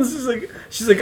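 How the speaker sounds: ordinary talk, nothing marked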